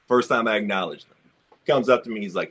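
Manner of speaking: usual speaking voice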